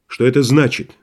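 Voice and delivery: plain reading voice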